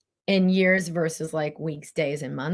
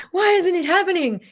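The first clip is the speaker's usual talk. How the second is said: much higher, louder pleading question; mock impatient whine; 13 semitones higher, 6 dB louder than usual. Impatient students asking her, high and loud.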